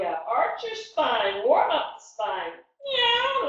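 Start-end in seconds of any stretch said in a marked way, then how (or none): 2.8-3.5 high playful meow; cat meow. Pretend. Teacher meows high during cat pose. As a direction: squeak it like a kitten. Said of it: "That's what a cat says".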